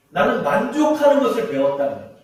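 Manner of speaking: normal talking voice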